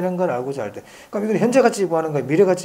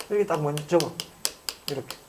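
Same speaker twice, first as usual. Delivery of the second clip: ordinary talk, nothing marked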